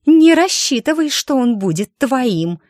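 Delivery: high, loud, spiteful threat; vicious hiss. Acted. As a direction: snarl it like a jealous rival. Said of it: "злобным голосом произнесла девица".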